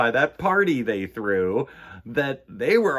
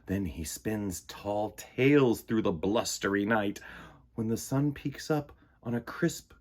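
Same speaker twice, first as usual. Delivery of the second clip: slow, gentle picture-book read; reading to children; much slower than usual. A narrator's reading. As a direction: ease the story to a sleepy close. Storybook narration ending.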